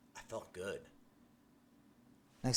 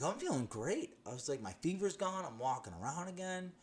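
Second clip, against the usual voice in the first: giddy excitement; 8 semitones higher than usual. Retold or imitated. Excited reply to doctor.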